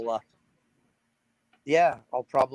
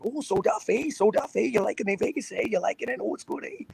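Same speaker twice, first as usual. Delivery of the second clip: higher, sing-song Canadian with eh; Canadian accent; 8 semitones higher than usual. Retold or imitated. Musician imitates people back home in Canada, higher Canadian accent. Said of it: "everybody is like".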